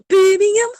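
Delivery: high, loud Birmingham Brummie impression; a hammed-up Brummie query; mock Brummie accent, pitched high. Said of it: "the only way I can do it when Birmingham is go".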